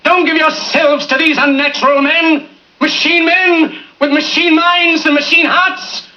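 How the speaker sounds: building to a soaring shout; impassioned speech. Acted. Speech builds to rousing climax.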